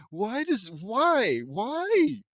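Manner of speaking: high wail of "why", rising; loud, despairing plea at the absurdity; exasperated disbelief. Real, not acted. Exasperated by the story.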